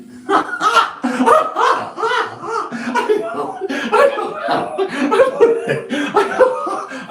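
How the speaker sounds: high, helpless cackling laugh; real laughter that keeps going; long laughing fit. Real, not acted. Long fit of laughter at own joke.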